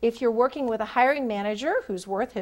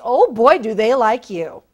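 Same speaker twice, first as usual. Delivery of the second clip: emphatic; 11 dB louder than usual. Real, not acted. Emphatic coaching point.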